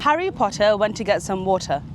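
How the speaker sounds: teaching a British accent sentence. Shown as a demonstration. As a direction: model the line in a British accent. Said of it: "Try this. Focus".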